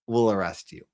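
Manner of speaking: ordinary talk, nothing marked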